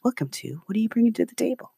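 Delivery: soft public-radio announcer, quick on the question; mock the calm NPR host; hushed radio host imitation. Shown as a demonstration. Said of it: "I like to do the N P R voice".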